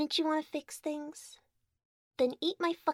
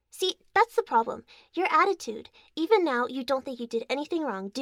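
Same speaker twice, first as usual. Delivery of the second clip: angry scolding; 8 dB louder than usual. Acted. Scolding partner's attitude.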